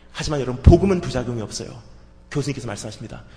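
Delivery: ordinary talk, nothing marked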